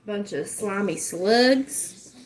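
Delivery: drawn out, every word stretched; slow preachy insult. Shown as a demonstration. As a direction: spit it out slowly like a sermon. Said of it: "I could get on here and preach about it".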